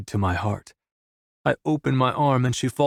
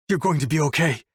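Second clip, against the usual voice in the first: desperate reassurance; 4 semitones higher than usual. A narrator's reading. Desperate man reassuring unresponsive lover.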